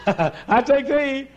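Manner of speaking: high, drawn-out mock vow; hammed-up bride, slow and high; imitated overwrought bride. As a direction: stretch it out in a silly high squeal. Imitates another bride's exaggerated vow.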